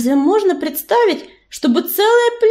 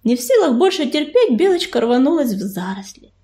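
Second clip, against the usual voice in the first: plain reading voice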